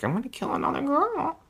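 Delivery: high, squawky mock threat; a killer threatening in a silly duck voice. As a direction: imitate a menacing cartoon duck. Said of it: "somebody who talked like a duck".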